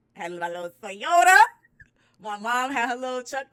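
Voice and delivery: loud, high hype; hyped. Real, not acted. Recounting the packed cars, loud and hyped. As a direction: brag it out.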